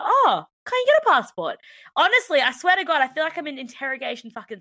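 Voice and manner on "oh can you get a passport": high, loud mock surprise; nosy questioner. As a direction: imitate a questioner's exaggerated, high-pitched amazement.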